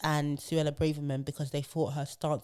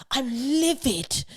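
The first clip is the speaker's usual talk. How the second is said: higher, slow, prim posh MP; posh calm fury; 7 semitones higher, 5 dB louder, much slower than usual. Retold or imitated. A posh Tory MP declaring his anger, slow and prim. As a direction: say livid as if it's the height of rage. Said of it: "he seems quite calm".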